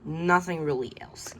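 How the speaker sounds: ordinary delivery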